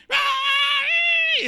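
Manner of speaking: very high, loud mock wail; imitated child's bawling. Children crying for a toy, imitated piercing wail. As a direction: shriek like a child in a tantrum. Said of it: "Están llorando".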